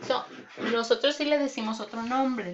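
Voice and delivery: normal talking voice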